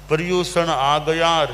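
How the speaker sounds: ordinary talk, nothing marked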